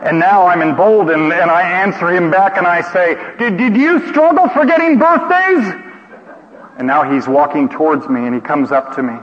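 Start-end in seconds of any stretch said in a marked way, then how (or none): 3.4-5.7 high, loud playful challenge; bold retort. Retold or imitated. Speaker answering the man back, high and bold. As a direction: fire back cheekily. Emboldened retort across room.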